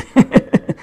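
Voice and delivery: sudden high laugh; burst of laughter. Real, not acted. Laughing at naming the real place. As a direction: laugh out loud, caught off guard.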